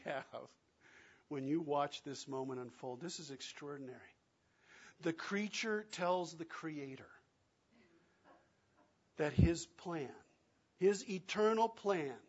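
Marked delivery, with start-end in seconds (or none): none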